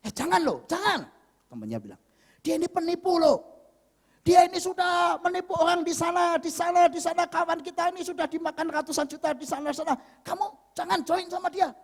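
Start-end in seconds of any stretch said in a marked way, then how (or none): 0.1-1.1 high-pitched urgent warning; alarmed warning. Retold or imitated. Friend warning against joining the swindler, alarmed. 2.5-11.7 high, insistent retold warning; urgent warning. Friend on the phone warning about a swindler, urgent.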